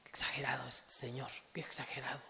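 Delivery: hushed, dismissive aside; a scornful whispered aside mocking the preacher. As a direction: whisper it like a scoffing listener.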